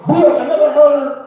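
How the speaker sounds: usual speaking voice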